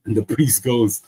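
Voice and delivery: normal talking voice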